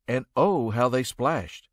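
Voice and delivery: ordinary delivery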